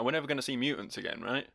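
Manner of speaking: normal talking voice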